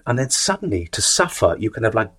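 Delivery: usual speaking voice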